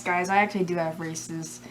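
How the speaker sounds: ordinary talk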